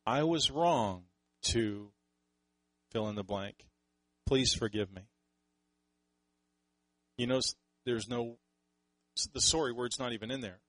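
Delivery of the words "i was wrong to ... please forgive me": slow, sincere "I was wrong to"; gentle, slow sincere apology. Shown as a demonstration. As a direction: model an honest confession, slowly. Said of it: "word it something like this".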